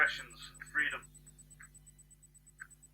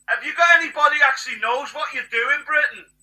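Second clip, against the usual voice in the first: higher and louder, demanding; angry shouted challenge; 9 semitones higher, 11 dB louder than usual. Real, not acted. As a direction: hurl the question at Britain. Ranting, challenging Britain.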